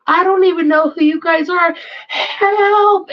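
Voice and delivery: high-pitched mock cry for help; imitated screaming child. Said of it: "And starts screaming and waving his hands".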